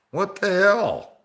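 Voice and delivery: exaggerated, drawn-out what the hell; mock disbelief. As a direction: throw it up high in put-on shock. Mock outrage at the mix-up.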